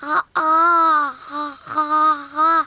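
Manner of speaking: talking with mouth wide open; garbled open-mouthed sounds. Acted. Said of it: "C'est très difficile de parler avec la bouche grande ouverte".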